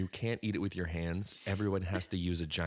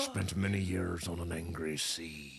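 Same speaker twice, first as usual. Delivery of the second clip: put-on salty old fella; 4 semitones lower than usual. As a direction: play a weathered old sailor. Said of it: "just a salty old fella".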